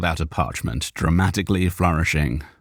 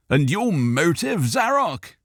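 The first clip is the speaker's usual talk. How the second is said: inquisitive gentleman; 11 semitones higher than usual. Acted. Cal asking.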